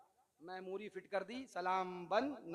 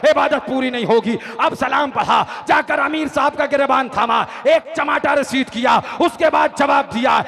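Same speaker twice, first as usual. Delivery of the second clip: loud, driving sermon narration; impassioned rhythmic declamation; full-force preaching; 6 semitones higher, 22 dB louder than usual. A narrator's reading. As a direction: build each line toward a climax.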